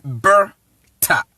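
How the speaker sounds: "berta" slowly split as "ber... ta"; slow syllable demo. Shown as a demonstration. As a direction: pause between the two halves of "berta". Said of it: "Ber, because I am speaking from".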